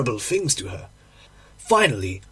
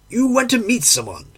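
mildly raised, higher-pitched accusing cry; 7 semitones higher, 3 dB louder than usual. A narrator's reading. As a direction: accuse with a touch of outcry, pitched up. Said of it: "I cried".

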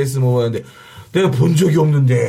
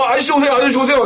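호객꾼 흉내; 9 semitones higher, faster than usual. Retold or imitated. 진행자의 호객을 흉내 냄.

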